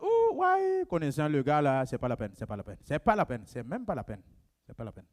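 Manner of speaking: low, dropping, scornful repeated dismissal; dismissive gossip. Retold or imitated. Kossi voiced dismissing Bakiono behind his back, scornful and low. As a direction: brush him off with contempt, voice sinking.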